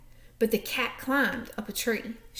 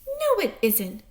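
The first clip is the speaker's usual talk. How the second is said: snapped; 8 semitones higher than usual. Acted. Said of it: "snapped Shelley".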